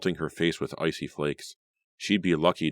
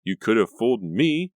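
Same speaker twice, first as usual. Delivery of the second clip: louder, higher angry retort; snapping back; 6 semitones higher, 6 dB louder than usual. A narrator's reading. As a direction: snap back at her. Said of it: "Reva snapped".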